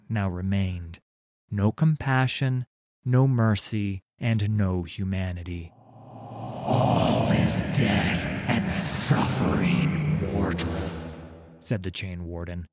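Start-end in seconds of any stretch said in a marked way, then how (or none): 5.7-10.7 slow rasping ghostly whisper; a sinister wraith savouring torment; cold hissing layered whisper. Acted. Thresh's voice.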